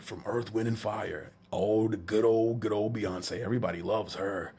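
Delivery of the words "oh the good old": ordinary delivery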